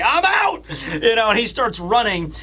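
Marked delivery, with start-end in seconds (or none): 0.0-0.6 high, yelped exit line; panicked bail. Retold or imitated. Road fleeing the creepy room, high panicked yelp. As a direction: squeal it and bolt. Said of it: "Road is just like".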